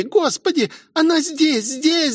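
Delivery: very high frightened squeal; shrieky high girl's voice; squealing in panic. A narrator's reading. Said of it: "заверещала Роузи".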